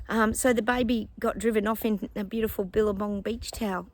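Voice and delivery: ordinary talk, nothing marked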